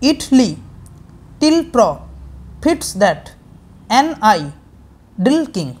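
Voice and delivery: two syllables at a time, pausing after each foot; divided into feet. Shown as a demonstration. Teacher showing five parts, "Italy, টিল প্র" in spaced pairs. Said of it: "কিভাবে পেলাম?".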